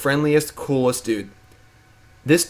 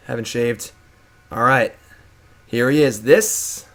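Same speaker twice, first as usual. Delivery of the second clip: ordinary talk, nothing marked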